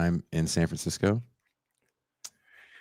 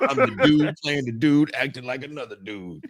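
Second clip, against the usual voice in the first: higher, laughing movie impression; tossing off the famous line; lightly voiced film line, fading into laughter; Robert Downey quote; 6 semitones higher than usual. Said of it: "quoting Robert Downey's line".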